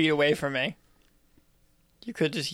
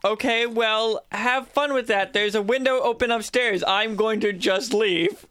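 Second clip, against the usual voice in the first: slow, higher-pitched call; raised, deliberate voice; calling out a farewell; 5 semitones higher than usual. Acted. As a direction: announce your exit down the stairs, drawing words out. Calling down to companions before leaving.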